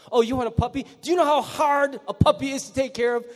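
incredulous parent scoffing at a puppy request; exasperated parent. Retold or imitated. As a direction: play the fed-up mom scolding the kid.

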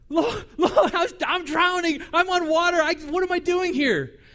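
high, loud frantic pleading; panicked cry. Acted. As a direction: cry out as you start to sink. Peter sinking in the waves.

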